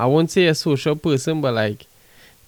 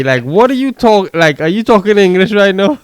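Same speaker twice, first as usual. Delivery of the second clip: louder, higher confused rant; exasperated mock; 6 semitones higher, 7 dB louder than usual. Stranger confused by his reply, louder exasperated disbelief. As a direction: pile on the disbelief, a bit loud. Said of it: "They'll be like".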